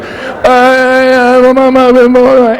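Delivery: high, loud urgent grunts; Ozzy grunting. Retold or imitated. Ozzy getting an idea, high loud grunts. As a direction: strain out an idea. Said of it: "he goes like".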